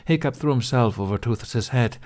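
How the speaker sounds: ordinary delivery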